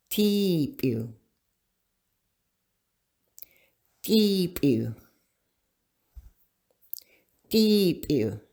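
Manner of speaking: Deep U, long ee then clear oo, paced slowly; Deep U said carefully, leaving room to echo; repeat-after-me Deep U. Shown as a demonstration. Teacher leading class to repeat Deep U, careful vowels. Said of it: "practice this three times together".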